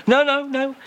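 ordinary delivery

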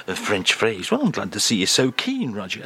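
ordinary delivery

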